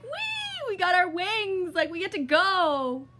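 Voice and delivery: high, loud squeals of joy; pumped and gleeful. Retold or imitated. Telling how she cheered for her dream wings, high and loud. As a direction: squeal it out like a kid let loose. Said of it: "fucking pumped".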